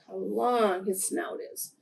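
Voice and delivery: usual speaking voice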